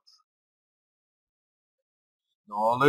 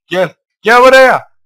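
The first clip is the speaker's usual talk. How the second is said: loud, high calling out to the creature; excited calling out; 11 semitones higher, 20 dB louder than usual. Real, not acted. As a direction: call it over loudly and eagerly. Calling a Pokemon to come. (heyecanlı seslenme)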